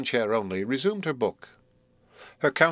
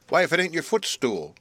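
higher-pitched cry of discovery; exclaiming nurse; 7 semitones higher than usual. A narrator's reading. Nurse finding her mistress's footstool, exclaiming. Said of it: "cried Flopson".

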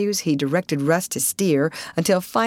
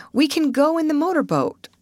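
high-pitched eager suggestion; excited cry; 10 semitones higher than usual. A narrator's reading. Young woman offering the motorboat, crying out. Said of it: "cried Mabel".

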